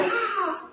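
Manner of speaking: high, soft mock "ah"; imitated high cry of surprise; mock startled cry. Acted reaction on lowering window.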